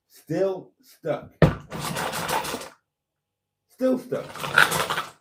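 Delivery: pushing hard, strained voice; straining effort. Real, not acted. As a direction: force the words out while pulling. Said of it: "But that was harder work".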